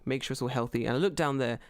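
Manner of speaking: usual speaking voice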